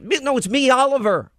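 lightly voiced eager Oliver; insistent caller. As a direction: push it, he won't give up. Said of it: "And he's like".

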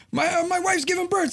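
high mock-urgent plea; urgent husband. Driver to cop about wife giving birth, mock urgent.

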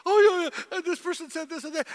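very high, loud excited retelling; gossip voice. Retold or imitated. Pastor mocking churchgoers retelling a hurt, high and shrill. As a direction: spill it like juicy gossip.

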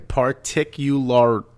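slow, broken-up "particularly"; syllable-by-syllable retry. Shown as a demonstration. Co-host proving he can say it, slow "particularly". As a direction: pick through "particularly" one syllable at a time. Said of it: "Now you're making me screw up".

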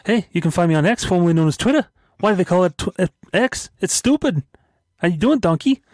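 squeaky cartoon sign-off; high silly voice.

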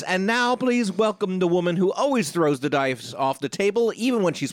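big build-up host introduction, stretching the name out slowly; announcer intro. Acted. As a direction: announce her like a showbiz star. Introduces the star guest.

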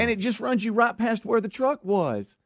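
high-pitched imitation of the exasperated owner; exasperated explaining. Owner repeats directions to lost driver, mock exasperation.

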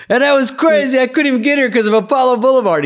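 high-pitched imitation of a comic mid-act; hammy headliner selling a local bit; comedian killing onstage. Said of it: "the guy gets up and he starts killing".

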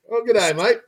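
high, hammed-up Australian greeting; mock Aussie, way up and bright; Australian accent. Host imitating Australian listeners greeting him, hammed-up Australian accent. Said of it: "you just gotta love it when they".

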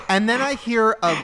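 usual speaking voice

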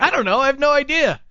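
high, exasperated I-don't-know; high mock shrug. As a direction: whine it back helplessly. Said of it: "I'm like".

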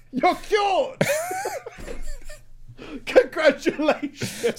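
high, laughing "you're cured"; mock celebration. Mock cheer at grim news.

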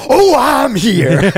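very slow, high, drawn-out put-on voice; quirky alter voice. Comedian voicing quirky alter Cosmo arriving, very slow and airy. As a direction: float in dreamily as the quirky one.

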